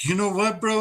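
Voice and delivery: ordinary talk, nothing marked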